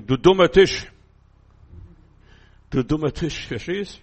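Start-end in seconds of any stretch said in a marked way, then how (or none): 0.0-0.9 put-on loud, childish scolding of the table; child's angry outburst. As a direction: shout it like a cross little kid. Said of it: "dann sagen sie".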